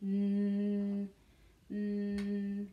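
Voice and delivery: N as a long steady hum "nnn"; isolated letter sound. Shown as a demonstration. Teacher asks which letter, humming a held N. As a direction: hold the N sound on one level pitch. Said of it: "quelle lettre?".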